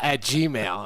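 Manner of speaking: ordinary delivery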